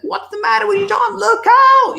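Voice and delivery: very high, frustrated shout, loudest on look out; exasperated yell. Pretend. You yelling at friend John who ignores the train, very high exasperated shout. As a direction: yell at him, fed up he won't listen. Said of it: "and you're like".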